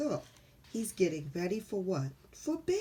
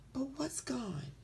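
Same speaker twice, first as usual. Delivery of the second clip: whispering; 5 semitones higher, 6 dB quieter than usual. Real, not acted. Whispered question to children.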